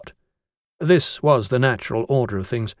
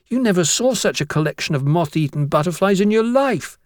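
put-on high-pitched boy finishing his tale; chattering schoolboy; 6 semitones higher than usual. Blotwell mourns the moth-eaten collection, high schoolboy voice. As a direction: lament it as a young pupil.

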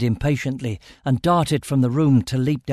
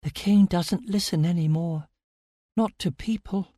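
put-on high-pitched, fragile girl's voice; hopeless; 6 semitones higher than usual. A narrator's reading. Amira tells narrator the king won't listen, hopeless. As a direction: give up, softening at the end. Bound girl, despairing of help.

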